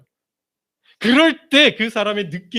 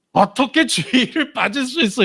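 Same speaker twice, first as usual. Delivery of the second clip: higher, reproachful mock quote; judgmental disbelief; 4 semitones higher than usual. Preacher imitating members judging an absent believer, reproachful. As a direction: scold like a shocked churchgoer. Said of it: "이렇게 되죠".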